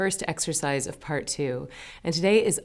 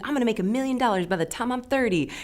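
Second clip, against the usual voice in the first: higher-pitched, mock-cocky boast; mock confidence; 6 semitones higher than usual. Teacher mimicking humans who think they're in control, mock confidence. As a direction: imitate someone naively sure of themselves.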